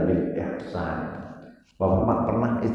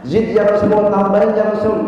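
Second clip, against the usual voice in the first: much higher, louder, begging harder; insistent pleading companion; 12 semitones higher, 7 dB louder, faster than usual. Acted. Companion begging the Prophet for more advice, urgent plea.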